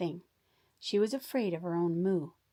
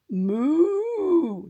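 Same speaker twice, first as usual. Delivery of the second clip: a high, loud moo; 10 semitones higher, 10 dB louder than usual. Acted. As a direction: let the moo ring out loud. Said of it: "just as loudly as possible".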